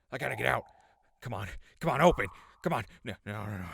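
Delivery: loud, frantic pleading with the lock; straining desperately at the cage; rising panic, near shouting; panicked struggle. Acted.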